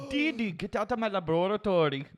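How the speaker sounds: put-on high, loud bratty boy genius; Dexter impression. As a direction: yell at a pesky sister like Dexter. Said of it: "That's pretty good".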